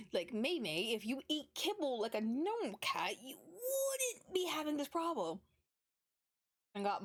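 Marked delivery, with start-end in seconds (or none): 0.3-5.4 mock scolding cat. Real, not acted. Scolding her cat about food.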